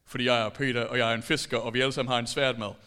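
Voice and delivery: a lightly voiced boastful Peter. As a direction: puff yourself up as Peter.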